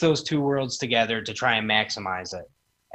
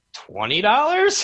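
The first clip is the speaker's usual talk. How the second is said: Twenty dollars? said slowly with rising question tune; unsure, upward-lilting price; 12 semitones higher, 4 dB louder, slower than usual. Retold or imitated. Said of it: "Why'd you say it like a question".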